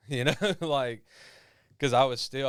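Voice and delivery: breaking into a real laugh mid-sentence; chuckling while he talks. Real, not acted. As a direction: laugh through the words, half embarrassed. Laughs at his own retold toughness.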